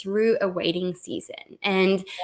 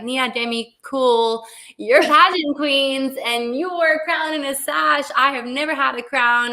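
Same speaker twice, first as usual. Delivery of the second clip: mock skeptical listener; 6 semitones higher, 6 dB louder than usual. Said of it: "you're like".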